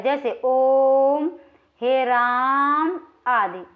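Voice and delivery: ओम and हे राम held very long and slow; prolonged vowels. Shown as a demonstration. Teacher demonstrating prolonged pluta vowels. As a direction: chant ओम and राम, holding the vowel three beats. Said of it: "इन्हें त्रिमात्रिक कहा जाता है".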